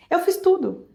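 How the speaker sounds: high-pitched reenacted disbelief; retold distressed protest. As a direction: protest as if wronged, pitch jumping up. Reenacting distress at negative result.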